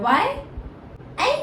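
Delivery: ordinary talk, nothing marked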